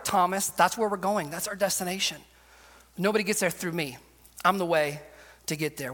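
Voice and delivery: low, soft and reassuring; calm Thomas down tenderly; Jesus reassuring doubting Thomas, low and soft; gentle Jesus. Acted. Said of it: "And Jesus replies".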